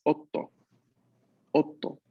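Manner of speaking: otto with a held t before the final o; otto, stop briefly on the t; double t. Shown as a demonstration. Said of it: "hacerle bastante énfasis en esta".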